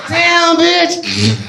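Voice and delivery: high, drawn-out mock exclamation; hammed-up craving. Comic acting himself begging for another line, hammed-up high craving. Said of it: "I'm in that bitch like".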